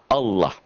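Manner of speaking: "Allah" as "Aulloh"; rounded, thick "Allah". Shown as a demonstration. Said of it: "seakan-akan ada A W L O H".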